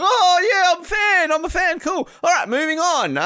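very high shouted mock athlete. Imagined Canadian athlete brushing off the host, very high shout.

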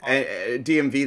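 ordinary talk, nothing marked

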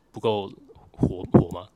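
ordinary talk, nothing marked

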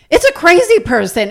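mock outburst from dad, high and loud; dad's line. Dad explaining pervert to his kid, a loud high blurt. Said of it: "my dad's from Lebanon ... thick accent".

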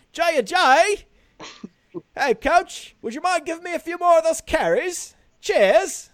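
a cheeky Brit asking the coach for carries; British accent. Acted. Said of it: "he has a British accent".

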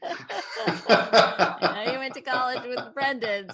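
words spilling out through a laugh, slowed. Real, not acted. Host cracks up at guest's age joke, laughing through it. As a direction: still laughing at the joke while talking.

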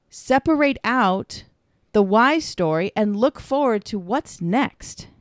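ordinary talk, nothing marked

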